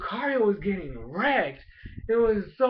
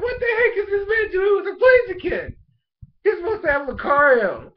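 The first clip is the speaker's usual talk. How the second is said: much higher, louder shocked yell; shocked outburst; 11 semitones higher, 6 dB louder than usual. Retold or imitated. Fan shocked that grandpa has Blaziken, disbelieving yell. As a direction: shout in disbelief at the screen. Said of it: "I'm like".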